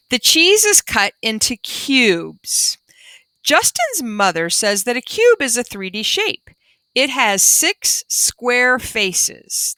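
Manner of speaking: slow, clear storybook reading; reading to children. Acted. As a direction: read it out slowly for the class. Reading the class book aloud.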